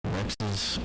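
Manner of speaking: loud mock on-air voice; satellite radio host. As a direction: ramble loudly on air as a rant host.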